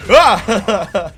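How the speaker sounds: sudden loud high shriek; a startled shriek at the screen; startled yelp. Real, not acted. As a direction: yelp out in shock. Reacting to the game.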